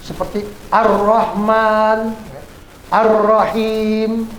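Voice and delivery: Ar-Rahman, Ar-Rahim with silent l and a long held r; drawn-out Ar-Rahman, Ar-Rahim, doubled r; al assimilated. Shown as a demonstration. Teacher showing pupils silent al, slow assimilated r. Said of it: "al-nya tidak terdengar".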